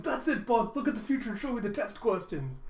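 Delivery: put-on high, frantic child demanding help; hammed-up desperate excitement. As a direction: plead urgently in a high kid voice. Panicking child shakes dog, demanding help.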